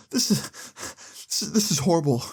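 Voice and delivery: high, halting crying-mom voice; imitating a mother choked by tears; mock sobbing. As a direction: struggle to get words out between sobs. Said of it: "can't really get it out".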